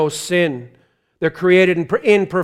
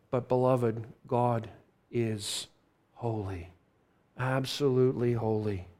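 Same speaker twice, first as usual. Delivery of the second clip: much softer, lower, each word set apart by pauses; hushed solemn emphasis; 7 semitones lower, 13 dB quieter than usual. Real, not acted. Preacher declaring God's holiness, hushed and deliberate. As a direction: drop to a reverent near-hush on God is holy.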